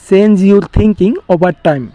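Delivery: very slow, careful English; slow model sentence. Shown as a demonstration. Teacher giving the English translation, slow and deliberate. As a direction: lay each word out slowly. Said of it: "এটা ইংলিশে কি হবে?".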